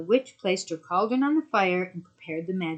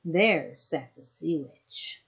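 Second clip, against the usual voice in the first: ordinary delivery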